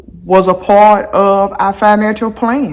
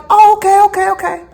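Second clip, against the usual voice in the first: high, bright-eyed reported surprise; surprised oh okay; 13 semitones higher, faster than usual. Retold or imitated. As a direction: act taken aback but pleased. Reacting to casino email.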